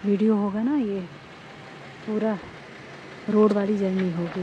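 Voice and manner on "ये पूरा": ordinary talk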